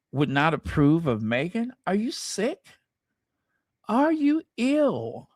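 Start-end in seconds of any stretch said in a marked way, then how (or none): 1.9-5.3 high, stretched-out indignant questions; incredulous scolding. Real, not acted. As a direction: challenge an absurd idea in disbelief. Scoffs at the experts' idea.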